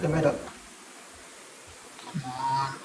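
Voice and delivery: ordinary talk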